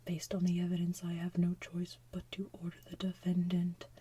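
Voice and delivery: whisper. Real, not acted. Whispers the whole retelling.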